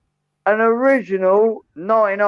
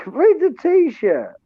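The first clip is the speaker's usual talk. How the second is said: high, drawn-out exasperated plea; exasperated insistence; 10 semitones higher, much slower than usual. Real, not acted. Host urging a viewer to read his shirt, exasperated. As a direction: stretch it out slowly, pitched high in disbelief.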